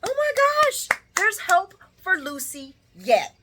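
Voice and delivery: high, thrilled exclamation; excited gasp. Retold or imitated. Vlogger thrilled that Lucy might change, excited. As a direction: gasp with delight. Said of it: "I'm like".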